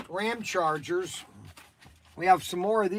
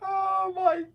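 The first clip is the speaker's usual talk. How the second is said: half-laughing whine; 11 semitones higher than usual. Real, not acted. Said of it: "I'm about ready to cry".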